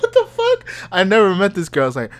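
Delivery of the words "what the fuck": very high, laughing what the fuck; laughing disbelief. Retold or imitated. Reacting to the coworker's claim, very high laughing disbelief. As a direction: let it spill out through laughter.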